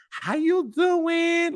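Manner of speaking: very high, slow flirty greeting; flirty bartender. Retold or imitated. Mocking bartender chatting up her date, high slow. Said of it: "She is like".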